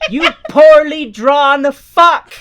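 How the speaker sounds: mock rage, yelled and laughing; mock furious yell. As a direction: scream it at the screen while cracking up. Yelling at the cartoon character.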